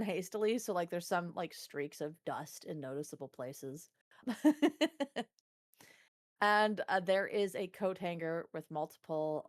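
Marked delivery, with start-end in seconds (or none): 4.2-5.2 laugh. Real, not acted. Laughs at own description.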